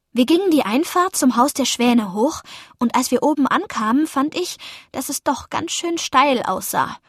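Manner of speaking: ordinary delivery, nothing marked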